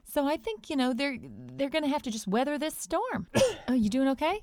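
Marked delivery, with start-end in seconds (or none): 3.3-4.4 sputtering after a gulp; choking on a drink. Real, not acted. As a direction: drink caught in the throat. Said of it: "drinking some muscle milk and it got stuck in my throat".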